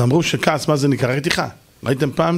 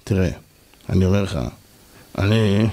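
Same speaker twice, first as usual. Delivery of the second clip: low, halting, placid voice; calm, gentle speech; 8 semitones lower than usual. Shown as a demonstration. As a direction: try to get angry while staying utterly calm. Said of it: "תנסו לדבר בנחת".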